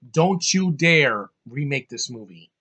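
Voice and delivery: slow, emphatic, hitting DON'T; stern warning. Real, not acted. Warning Hollywood never to remake this film, stern and slow. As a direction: lean hard on "don't" and drag it out.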